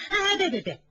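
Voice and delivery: high, rushed cries of no; hasty pleading. A narrator's reading. Ping'er hurriedly begging Yuanyang to stop, high and rushed. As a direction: grab her back before she storms off.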